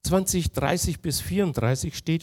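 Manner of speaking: ordinary delivery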